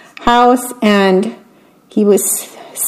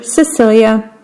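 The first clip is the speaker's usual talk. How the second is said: ordinary delivery, nothing marked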